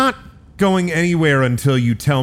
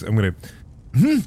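ordinary delivery

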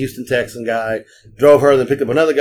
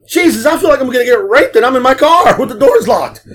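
much higher, nervous half-joking chatter; scared chatter; 10 semitones higher, 4 dB louder than usual. Retold or imitated. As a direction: spook yourself on the dark road. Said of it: "I said".

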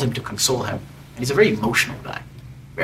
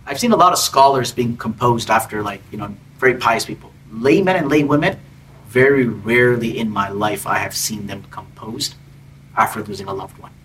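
ordinary talk, nothing marked

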